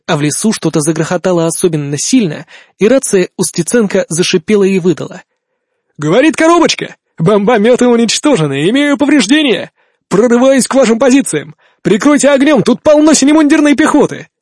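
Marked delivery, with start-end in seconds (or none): 6.0-14.3 urgent radio shout. Acted. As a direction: report urgently under fire. Urgent radio report under fire.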